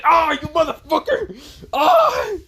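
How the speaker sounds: very high, loud agonized shriek; screaming in pain. Acted. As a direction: curse through hammed-up agony. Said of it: "just in pain".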